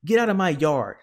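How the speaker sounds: outraged storybook holler; angry shout. A narrator's reading. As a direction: yell it while chasing someone off. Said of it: "shouted Eugenia".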